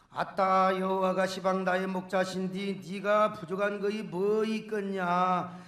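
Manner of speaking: psalm in a Jeolla dialect. Acted. Preacher performs the Jeolla version of the psalm, Jeolla dialect. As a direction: put on a folksy Jeolla accent. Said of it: "넘버원 전라도 버전".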